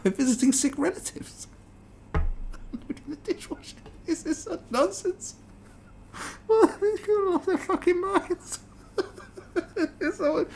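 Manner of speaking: high squeaky voice breaking into laughter; laughing in disbelief. Real, not acted. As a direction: laugh it off as nonsense. Laughing at article.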